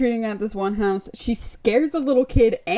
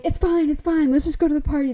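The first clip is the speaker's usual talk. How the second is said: breezy brush-off; 6 semitones higher, faster than usual. Retold or imitated. Brushing off a worried friend.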